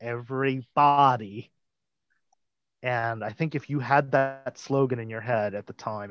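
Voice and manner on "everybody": "everybody" drawn out slowly for emphasis. Retold or imitated. Explaining that Klugman isn't doing an accent, emphasis on "everybody". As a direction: lean hard on "everybody", stretching it out. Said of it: "He's just emphasizing".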